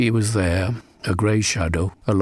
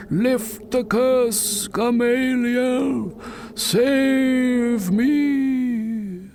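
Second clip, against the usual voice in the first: slow, high wailing plea; ghostly crying; much slower than usual. Acted. Pete cries downwind to torment Gamaliel, high ghostly wail. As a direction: wail it into the wind like a cursed spirit. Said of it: "crying downwind".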